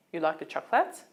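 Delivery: ordinary delivery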